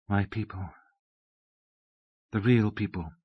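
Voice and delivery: soft, low-key awed murmur. A narrator's reading. Abbess hugging herself, speaking of her people, soft. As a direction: say it softly, hugging the words to yourself. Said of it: "She said softly".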